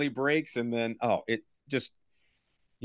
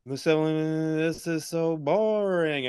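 bored; 3 semitones higher, much slower than usual. Retold or imitated. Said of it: "when he's like".

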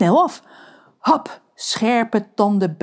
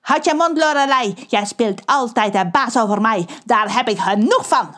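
sulky, higher furious snap; angry outburst; 4 semitones higher than usual. Acted. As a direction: lash out at the bossy mermaid. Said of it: "roept Pedro". (Pedro roept driftig)

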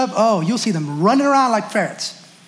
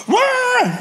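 high-pitched imitated parental outburst; hammed-up high "wah"; mock freak-out wail; 13 semitones higher than usual. Parent freaking out while disciplining.

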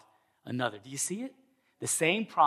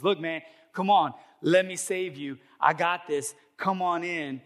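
acted boatman calling, raised and louder; higher, louder rescue plea; urging rescuer; 5 semitones higher, 6 dB louder than usual. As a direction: coax him aboard insistently. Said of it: "Local Cajun Navy".

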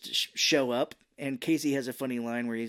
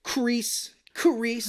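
much higher, taunting repetition; mocking crease; 10 semitones higher, 4 dB louder than usual. Danny creasing April's drawings, mock smug voice. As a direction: mimic him folding the drawings smugly. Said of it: "he's like".

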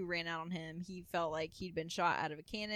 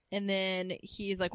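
usual speaking voice

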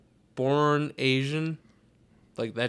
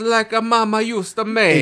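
hammed-up Italian accent, high and loud; 8 semitones higher, 9 dB louder than usual. Friend imagining the daughter eating cheesecake, mock Italian accent. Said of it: "she has, like, a fucking Italian accent".

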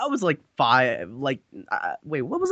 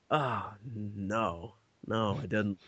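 much lower and softer guilty admission; sheepish kid; 9 semitones lower, 11 dB quieter, much slower than usual. Retold or imitated. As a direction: mumble the confession like a caught child. Caught kid admitting to officer.

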